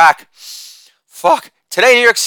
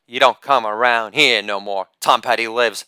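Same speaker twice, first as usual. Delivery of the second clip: a lightly voiced Southern croon, much lower and softer; 7 semitones lower, 5 dB quieter than usual. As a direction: croon it low in a half-hearted Southern accent.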